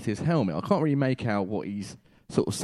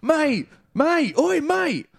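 high, louder shout of mate; 5 dB louder, much slower than usual. Retold or imitated. Husband yelling at the rider to stop, loud shout. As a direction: yell to make him stop. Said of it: "trying to shout".

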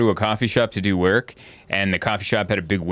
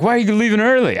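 concerned dad; 10 semitones higher, 4 dB louder than usual. Retold or imitated. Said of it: "He's like".

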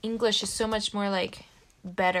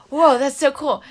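excited; 4 semitones higher, 10 dB louder than usual. Retold or imitated. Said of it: "And I was like".

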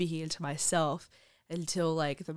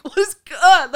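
baffled what; 10 semitones higher, 16 dB louder than usual. Retold or imitated. Baffled at the tapping exercise, loud incredulous cry. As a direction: stretch the words in confusion.

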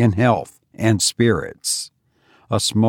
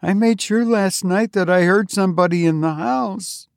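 higher, uneasy tone; Eunice higher and uneasy; nervous confession; 9 semitones higher than usual. A narrator's reading. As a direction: tell it as a frightened sister. Eunice confides her fear.

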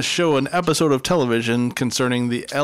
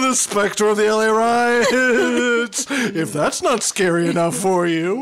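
high, slow, hammed-up spooky tease; spooky announcer; 8 semitones higher, 4 dB louder, slower than usual. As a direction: draw it out like a ghost story host. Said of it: "What a hard left turn I'm about to make".

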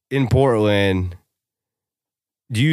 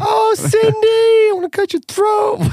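very high, slow falsetto with a crying wail; sing-song menace in a shrill falsetto; creepy falsetto bride. Acted. Angry bride fake sweetness.

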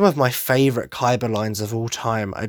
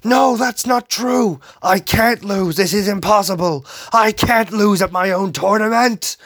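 panicked, raised and louder denial; mock frenzy; 7 semitones higher, 4 dB louder than usual. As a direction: freak out like a sore loser. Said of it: "he goes into a frenzy like".

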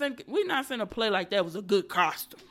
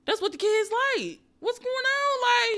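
incredulous rant; 13 semitones higher than usual. Real, not acted. Incredulous about costumes.

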